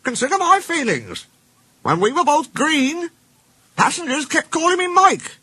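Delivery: very high mock-wounded voice; small engine Rex, teasing.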